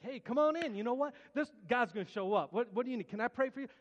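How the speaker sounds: overexcited church greeter welcoming people in; eager greeter. Retold or imitated. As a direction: greet everyone like the best news ever. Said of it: "But I was excited".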